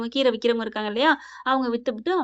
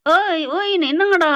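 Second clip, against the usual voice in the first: high, loud, drawn-out heckling; imitated fish-market clamour; 6 semitones higher, 6 dB louder than usual. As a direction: mimic a brawling market crowd. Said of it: "மீன் மார்க்கெட்டுக்குள்ள அடிச்சுக்குவாங்க இல்லையா". (சந்தைக் கூச்சல் போல சத்தமாக)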